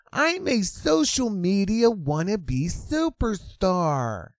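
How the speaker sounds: preen like a wannabe superstar; mock influencer voice. Vlogger mimicking the influencer at her rally, high and vain.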